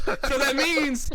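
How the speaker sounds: drawn out. Real, not acted. Repeated, drawn out for emphasis.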